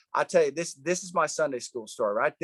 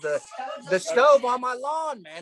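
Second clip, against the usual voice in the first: fired up; 8 semitones higher, 4 dB louder than usual. Retold or imitated. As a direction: hammer it out, indignant about the lawn. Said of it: "No, I'm fired up".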